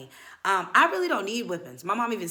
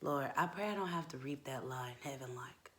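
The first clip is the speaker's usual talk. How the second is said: low, soft near-whisper; hushed prayer; 5 semitones lower, 12 dB quieter than usual. Pretend. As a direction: murmur a penitent aside to God. Murmured aside prayer for forgiveness.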